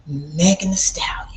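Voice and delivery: breathless whisper of the name. Real, not acted. Telling followers a touchy name in a whisper. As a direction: drop to a secret whisper.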